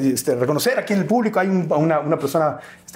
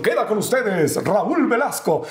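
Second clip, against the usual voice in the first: grand, drawn-out introduction, pitched up; TV show announcer; 4 semitones higher, slower than usual. Retold or imitated. Host presenting Velasco to a stadium, announcer style. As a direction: announce the star with long stretched words.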